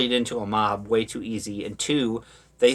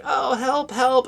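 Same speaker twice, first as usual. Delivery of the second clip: high-pitched put-on plea; mock cry for help; 13 semitones higher, 3 dB louder, slower than usual. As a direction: imitate a faked damsel-in-distress call. Imitating daughter faking calls for help.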